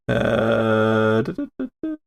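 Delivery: ordinary talk, nothing marked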